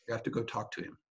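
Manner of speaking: low, hushed quoted advice; imitating a companion's soft, low instruction; quiet urging. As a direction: drop right down, quietly nudging someone. Companion's urging voiced in a story.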